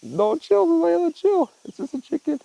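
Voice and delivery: high soothing voice to the cat; pet voice. Real, not acted. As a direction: coo to the cat to settle her.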